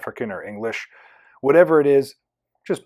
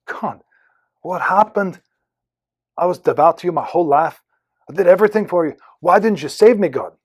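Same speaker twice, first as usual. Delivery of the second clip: put-on aggrieved, raised Irish-accented voice; 4 semitones higher, 5 dB louder than usual. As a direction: protest to God in a rough Irish accent, building louder. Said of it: "I'm going to do an Irish accent".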